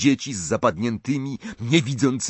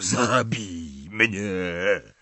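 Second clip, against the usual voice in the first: slow, low, rasping croak; hoarse croak; 3 semitones lower, 3 dB quieter, much slower than usual. A narrator's reading. Dumbledore begging for death, hoarse croak. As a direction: croak it out with no strength left. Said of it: "wychrypiał".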